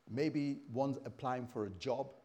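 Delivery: ordinary delivery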